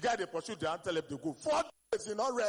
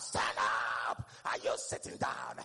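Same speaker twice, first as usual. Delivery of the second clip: mock pastor shout; 9 semitones lower than usual. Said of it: "Pastor, they shout".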